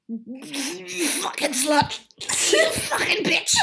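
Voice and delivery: slow then high, loud laughing insults; a laughing impression of the ex; mock ex-boyfriend. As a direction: ham up his rough bedroom talk. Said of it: "he used to be like".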